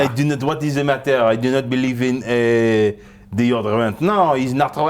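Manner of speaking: hammed-up French accent; heavy comic French accent. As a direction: protest like a mock Frenchman. Plays a Frenchman on deodorant.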